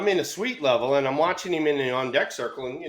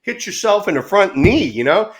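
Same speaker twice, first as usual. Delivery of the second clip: acted confrontation; 6 semitones higher, 6 dB louder than usual. Acted confrontation after soft preamble.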